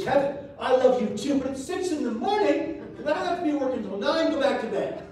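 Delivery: a woken father shooing his son back to bed; sleepy dad. Retold or imitated. Father woken at dawn tells son back to bed, mildly exasperated.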